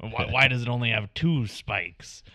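loud pirate-like voice; Clay voice. Retold or imitated. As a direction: bellow it like a pirate. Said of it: "my Clay voice sounds like a pirate".

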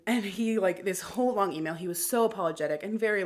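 ordinary talk

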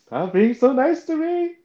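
high, loud, drawn-out whine; mock tearful. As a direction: wail it like someone upset, playfully exaggerated. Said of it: "upset and tears".

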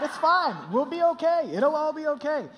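high, loud imitation of breezy reassurance; carefree wife. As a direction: brush off the worry airily. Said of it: "You don't care. You're like".

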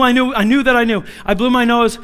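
ordinary talk

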